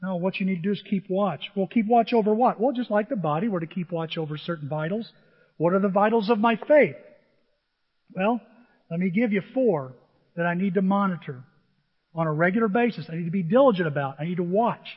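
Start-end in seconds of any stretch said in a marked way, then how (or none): none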